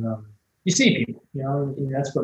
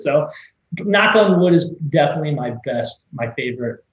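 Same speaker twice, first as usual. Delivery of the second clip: normal talking voice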